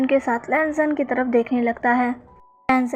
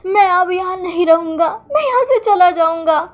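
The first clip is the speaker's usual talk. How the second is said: high, louder crying reproach; tearful complaint; 5 semitones higher, 4 dB louder than usual. Acted. Wing to husband and son, crying that they always make him cry, high tearful wail. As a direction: wail it through tears, pitched up. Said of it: "wing रोते हुए लैंजन को देखता है".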